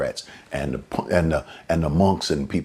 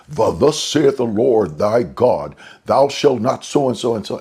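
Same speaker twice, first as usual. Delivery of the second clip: mock King James prophecy; 6 semitones higher, 5 dB louder than usual. Mocking churchgoers who prophesy in Old English. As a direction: thunder it out like an old-time prophet. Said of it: "when they try to prophesy to people ... in Old English terms".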